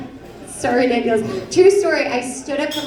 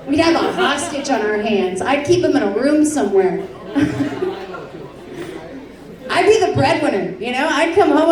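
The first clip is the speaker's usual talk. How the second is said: ordinary talk, nothing marked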